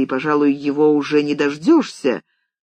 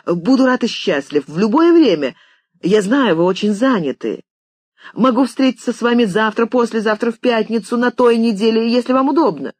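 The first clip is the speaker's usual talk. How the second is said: higher, breathless-to-please patter; eager gushing; 6 semitones higher, 3 dB louder than usual. A narrator's reading. George offers the famous writer any day for lunch, eagerly. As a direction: tumble through every possible day without letting the pitch drop.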